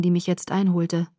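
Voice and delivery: ordinary delivery, nothing marked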